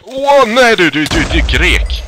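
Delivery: real fright, shriek it out; startled shriek. Real, not acted. Startled by a mob. (skräckslaget skrik)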